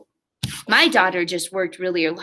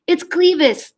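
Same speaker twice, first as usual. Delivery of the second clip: shouted; 8 semitones higher, 3 dB louder, slower than usual. Acted. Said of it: "they shouted".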